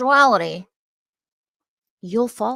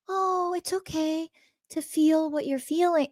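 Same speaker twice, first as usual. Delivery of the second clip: put-on a sugary, high coo to a crying child; passive pathetic mom; 9 semitones higher, 4 dB quieter than usual. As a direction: imitate a limp, pleading mother. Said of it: "she went into this passive, pathetic".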